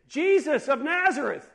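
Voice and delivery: loud, high cry to a passerby; high-pitched acted shout. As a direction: shout the name out over a crowd. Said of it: "he began to shout out and say".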